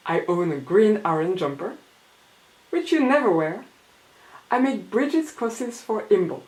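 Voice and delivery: reading her comic aloud, lightly performed; reading aloud in English. Acted. Reading her zine in English to a French audience. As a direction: read each line to the audience.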